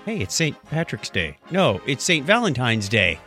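ordinary delivery

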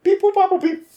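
high robotic beeps; toy robot voice. Acted. Random generator noises.

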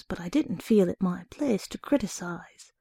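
ordinary delivery, nothing marked

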